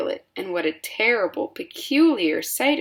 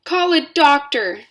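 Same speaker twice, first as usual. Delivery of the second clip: high loud shout; 8 semitones higher, 7 dB louder than usual. A narrator's reading. Father shouts for a doctor, high and loud. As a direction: yell for help. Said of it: "shouted Mister Beauregard".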